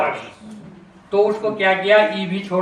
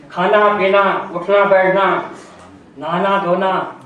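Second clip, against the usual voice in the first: slow listed pairs; slower than usual. Shown as a demonstration. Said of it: "इसको अलग अलग".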